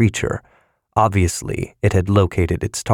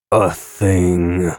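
resigned weariness. A narrator's reading. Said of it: "with resigned weariness".

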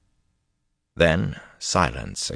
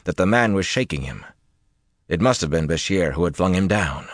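ordinary delivery

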